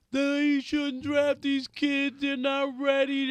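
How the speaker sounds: high, slow, whining complaint; whiny voice. Retold or imitated. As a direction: whine it out like a complainer. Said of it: "My whiny voice".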